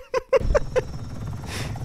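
breathless squeaky laugh; high wheezing laugh. Real, not acted. Laughing after friendly fire.

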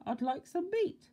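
tiny, very high squeaky voice; high-pitched mock whisper; timid whisperer. A narrator's reading. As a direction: squeak it like a timid little man. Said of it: "he whispered".

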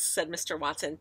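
usual speaking voice

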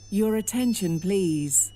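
normal talking voice

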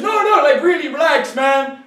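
very high, laughing calm-down voice; high laughing reassurance; mock mugger soothing. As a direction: plead with him to relax while laughing. Mugger calming him again.

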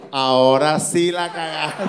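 slow, drawn-out verdict from above; voice from heaven. Acted. God after the man obeys, slow heavenly verdict. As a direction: pronounce the doom slowly, as God. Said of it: "Y salió la voz".

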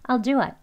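"I'll do it" as "al-du-at"; fast casual "I'll do it"; quick conversational form. Shown as a demonstration. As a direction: reduce I'LL to "al" and IT to "at". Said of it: "빨리 지나가는 발음으로".

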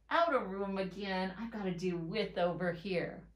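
ordinary delivery

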